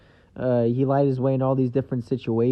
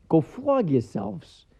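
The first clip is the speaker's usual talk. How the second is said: delighted repeat; 10 semitones higher, 4 dB louder, much slower than usual. Retold or imitated. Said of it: "Have you ever heard that?".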